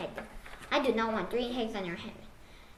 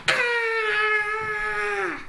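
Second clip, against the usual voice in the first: high startled shriek of alarm; startled scream; 7 semitones higher than usual. Acted. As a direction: let out a sudden frightened scream. Gerald startled by bird.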